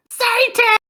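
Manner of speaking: tiny squeaky shriek, very high; twinkling breathy fairy whisper. Acted. GM voices a little voice.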